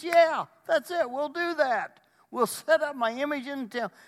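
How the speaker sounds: very high, loud acted outburst; mock gleeful agreement. Antichrist agreeing to his image in the temple, mock glee. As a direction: shout it high, seizing on the plan.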